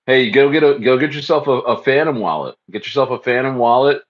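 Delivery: ordinary delivery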